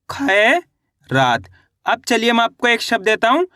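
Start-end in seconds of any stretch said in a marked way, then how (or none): 0.1-1.5 "खैरात" drawn out, खै rising, pause, then रात; syllable by syllable. Shown as a demonstration. Teacher reads back the spelled word to learners, slowly split in two. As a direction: hold खै in "खैरात", wait, then drop onto रात. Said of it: "तो मिलके बन गया ये".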